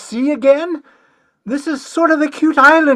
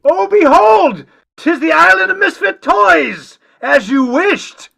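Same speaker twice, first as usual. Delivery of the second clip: grand announcement; 6 semitones higher than usual. Acted. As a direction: herald the discovery grandly. Romeo announces the island.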